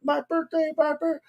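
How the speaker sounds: very high, soft mock fan voices; small, squeaky take on a pleading fan; eager fans. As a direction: imitate excited fans in a tiny high squeal. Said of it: "a bunch of people had signs up".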